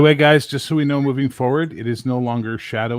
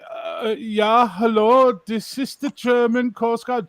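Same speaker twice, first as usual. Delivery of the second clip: put-on high, hesitant German-accented answer; German coast guard; 10 semitones higher, 3 dB louder, much slower than usual. Young German guard answers a mayday, high accented voice. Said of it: "the young guy sits down".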